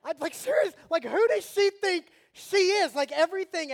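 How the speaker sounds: playfully furious, building heat; mock fury. Real, not acted. Dad reliving his anger at dinner, hammed-up fury. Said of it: "I'm serious".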